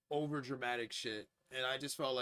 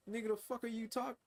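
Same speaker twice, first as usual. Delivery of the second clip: incredulous retort; 10 semitones higher than usual. Retold or imitated. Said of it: "I'm just like".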